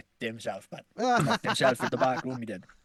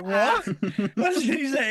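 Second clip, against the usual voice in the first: shocked what; 11 semitones higher, 6 dB louder than usual. Retold or imitated. Retelling shocked phone call.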